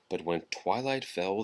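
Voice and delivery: usual speaking voice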